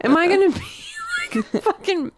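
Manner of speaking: high, wailing comic alarm; hammed-up worried whine; mock panic. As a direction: fret in a high, half-joking panic. Comic alarm over teeth dreams.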